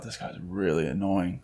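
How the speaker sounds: low, very soft aside; muttered thought. Retold or imitated. Customer thinking to himself about the clerk, muttered. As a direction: voice a private grumble under your breath.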